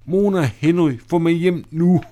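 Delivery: high, loud cry of panic; a scared girl begging to go home; panicked plea. Acted. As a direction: Clara cries out to Mona and Henry in panic. Clara panics in the dark storm.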